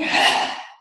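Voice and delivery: big noisy sigh out; loud volcano breath out; loud tantrum exhale. Shown as a demonstration. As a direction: blow it out like a volcano. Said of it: "make that noise as well".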